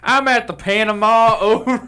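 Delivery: hammy CB sign-off; over walkie talkie. Pretend. Mock ship radio call.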